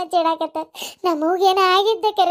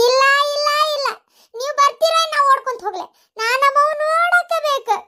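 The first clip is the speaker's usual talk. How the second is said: higher, distraught insisting; tearful pleading; 5 semitones higher than usual. Acted. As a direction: beg through tears, slowing at the end. Daughter begging to see mother.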